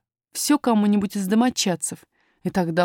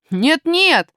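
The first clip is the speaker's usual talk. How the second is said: put-on higher, louder young lad; pitched-up, emphatic protest; boy miner objecting; 8 semitones higher, 6 dB louder than usual. A narrator's reading. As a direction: object firmly in a boy's voice. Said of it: "возразил маленький шахтёр".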